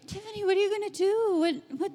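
a touch high, fast quizzing friend; mock eager questioning; nagging question. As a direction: pester her like curious friends. People asking about her future.

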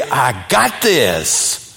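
mock macho guy. Guys bragging while the ladies giggle, slow mock swagger. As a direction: lean back and brag. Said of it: "guys say".

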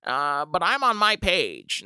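put-on high old lady voice, slowing at the end; indignant about hackers; aggrieved old neighbor. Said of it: "she's like".

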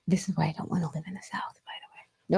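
quick hushed whisper; whispered aside. Real, not acted. Confiding why she won't live in the South, whispered. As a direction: drop into a fast whisper, as an aside. Aside before "No offense, guys.

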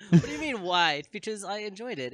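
loud, high-pitched indignant comeback. Real, not acted. Guest defending his show choice to the host, loudly indignant. Challenged over rewatching a show.

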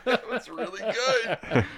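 laughing through it. Real, not acted. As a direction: say it while laughing. Laughing praise.

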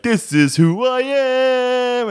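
high, slow, drawn-out hammed-up pride; mock grand declaration. Suitor to the girl, high drawn-out mock pride. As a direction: puff up and declare it to the world. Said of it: "and you're like".

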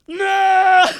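high, loud drawn-out mock cry; comic squealed no; wailed no. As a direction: wail the refusal like a child. Acts refusing God a third time.

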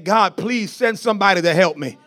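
imitated desperate prayer, pouring out in a rush; crying out to God. Preacher voices someone at home begging God for help, crying out. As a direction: plead urgently as the abandoned believer, without real sobs. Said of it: "someone is at home crying before the Lord".

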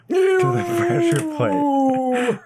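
very high, slow dramatic flourish; dramatic high reveal. Acted. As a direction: stretch it out theatrically high. Dramatic pointing at trap.

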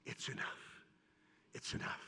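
breathy whisper, very soft; preacher whispering Jacob's words to the crowd; whispered resolve. Acted. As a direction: whisper it like a quiet surrender. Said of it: "do like Jacob saying".

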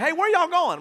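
put-on eager grown kids. Grown kids begging to join.

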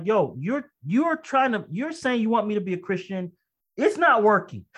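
retold high-pitched, exasperated rant; angry outburst at God. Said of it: "I'm cussing at God".

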